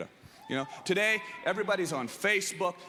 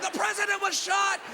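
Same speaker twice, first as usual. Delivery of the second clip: running shout; 13 semitones higher than usual. Retold or imitated. Kid running past hedges, high panicked shouting. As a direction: yell it while running around. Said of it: "running around screaming".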